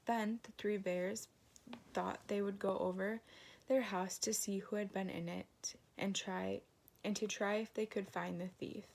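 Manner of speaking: plain reading voice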